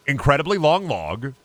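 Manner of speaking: ordinary talk, nothing marked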